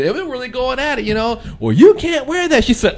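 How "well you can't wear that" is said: higher, louder, slow retold scolding; mock angry boyfriend. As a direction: play the fuming boyfriend, drawn out.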